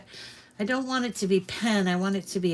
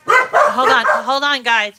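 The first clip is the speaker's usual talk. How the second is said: louder hurried hold on; telling them to wait; 2 semitones higher, 11 dB louder than usual. Real, not acted. Asking viewers to wait while she goes to Mom, louder. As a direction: call it out while rushing off.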